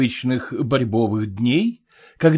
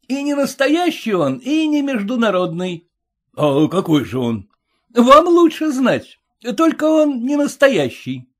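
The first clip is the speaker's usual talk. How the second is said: heckler voice; 11 semitones higher than usual. A narrator's reading. Heckler retorts from the crowd.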